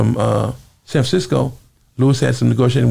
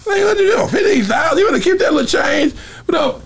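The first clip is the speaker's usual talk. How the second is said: high shouted scoff; shouting higher in retold disbelief; retold mock outrage. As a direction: push back hard at the small offer. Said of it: "I, like".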